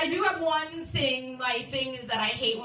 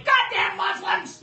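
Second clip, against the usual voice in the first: loud, slow, higher bitter shout; angry old man outburst; 8 semitones higher, 5 dB louder, slower than usual. Retold or imitated. Fifty-year-old man whose car won't start, loud slow shout. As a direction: bark it out at the broken car. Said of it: "he's just like".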